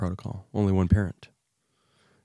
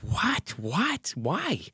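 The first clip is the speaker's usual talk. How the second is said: much higher, rising stunned questions; shocked disbelief; 11 semitones higher, much slower than usual. Retold or imitated. Dad hit by stroke news, high stunned disbelief. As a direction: sound blindsided and lost. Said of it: "so I'm like".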